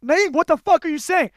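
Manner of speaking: high-pitched angry outburst, retold; mirrored yelling. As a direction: throw his anger right back at him. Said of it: "Because I literally mirrored it".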